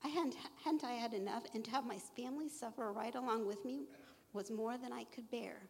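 ordinary talk, nothing marked

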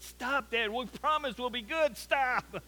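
lightly hammed-up children's plea; mock pleading kids. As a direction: play the kids begging, half-joking. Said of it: "They all cried louder and said".

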